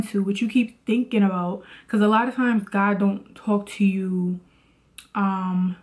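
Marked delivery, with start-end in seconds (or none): none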